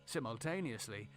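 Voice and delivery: ordinary delivery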